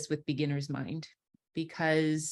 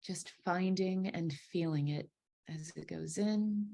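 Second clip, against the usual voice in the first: guided meditation; 5 dB quieter than usual. Acted. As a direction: ease them into their breath, unhurried.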